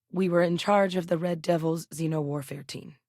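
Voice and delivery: normal talking voice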